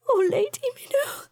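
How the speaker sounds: high, weeping sigh of a name; tearful sigh. A narrator's reading. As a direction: let the name fall apart in tears. Joanna crying into cat's fur.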